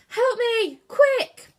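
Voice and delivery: high, loud, desperate shout; urgent cry for help. A narrator's reading. Cornelius wrestling the tiller, urgent cry. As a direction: call out in alarm.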